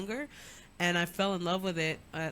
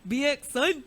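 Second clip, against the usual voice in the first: loud Bronx brag; Bronx swagger; 7 semitones higher, 8 dB louder than usual. Acted. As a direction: rep the Bronx hard. Bronx pride shout.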